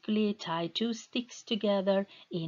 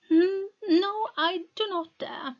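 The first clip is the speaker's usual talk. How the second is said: put-on much higher, timid girl's voice; frightened refusal; 11 semitones higher than usual. Meta refuses to enter the river, high and frightened. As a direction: shrink back, too scared to go in.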